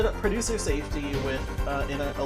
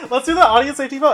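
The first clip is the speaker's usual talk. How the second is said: laughing; 9 semitones higher, 10 dB louder, faster than usual. Real, not acted. Laughing after own slip.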